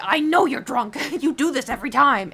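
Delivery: loud, high, fed-up scolding; exasperated scolding. Retold or imitated. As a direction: scold him, laughing a little. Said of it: "I'd be like".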